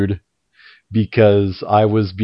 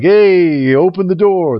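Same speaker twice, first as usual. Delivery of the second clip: put-on loud, higher New York attendant accent; 7 semitones higher, 4 dB louder, slower than usual. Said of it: "That was my New York accent right there".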